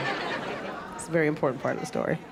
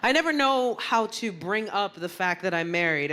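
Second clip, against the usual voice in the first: ordinary talk, nothing marked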